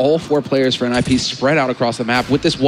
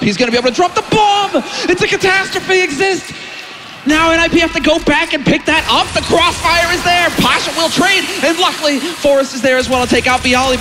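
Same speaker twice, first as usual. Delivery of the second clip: esports caster; 12 semitones higher than usual. Acted. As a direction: ride the hype of the round. Live play-by-play call.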